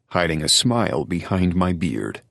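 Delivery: ordinary delivery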